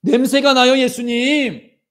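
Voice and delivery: high, loud, then slow drawn-out protest; pleading Martha. Acted. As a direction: beg Jesus not to open the tomb. Martha objects to Jesus. (높고 크게 애원하듯)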